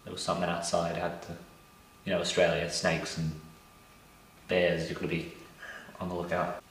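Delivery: quiet, watchful whisper-like talk; hushed. Real, not acted. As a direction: drop to a nervous hush.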